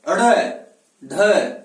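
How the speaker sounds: अड़ and ढ loud, tongue curled up and flicked; अड़, ढ as forceful, separate retroflex flaps; flapped retroflex demo. Shown as a demonstration. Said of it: "झटके से फेंक करके, तालु के सहयोग से".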